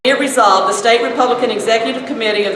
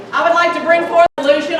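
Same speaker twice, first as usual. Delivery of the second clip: louder, projecting voice; 7 semitones higher, much faster than usual. Real, not acted. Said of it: "Can't hear you".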